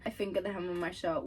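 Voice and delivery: ordinary talk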